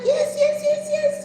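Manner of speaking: high-pitched, excited string of yeses; eager yes. Retold or imitated. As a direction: act out frantic relief, way up high. Retells eagerly answering the driver.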